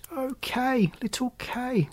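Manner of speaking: high, soft, longing sigh. A narrator's reading. Gerda sighs softly for her lost friend Kay. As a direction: breathe his name with longing. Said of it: "sighed Gerda".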